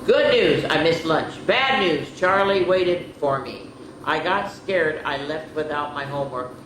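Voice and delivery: animated picture-book narration; reading to children. A narrator's reading. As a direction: read the diary like a bedtime story. Reads diary entries aloud.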